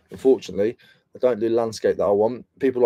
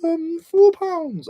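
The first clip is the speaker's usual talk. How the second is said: much higher squeaky seller voice; high put-on voice of a car boot seller; mock seller; 4 dB louder, much slower than usual. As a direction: play the meek stallholder. Role-plays car boot seller.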